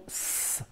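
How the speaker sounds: S said as a long hiss, sss; letter sound. Shown as a demonstration. Teacher shows pupil the end of the name, sounding S as a hiss. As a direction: hold S as a pure consonant, no vowel. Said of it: "le son S".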